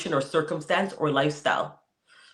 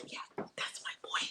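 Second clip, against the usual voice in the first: soft, breathy whispered praise under his breath; quiet proud whisper, not a scream; whispered cheer; 16 dB quieter than usual. Retold or imitated. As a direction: murmur it proudly to yourself. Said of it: "I'll just be like".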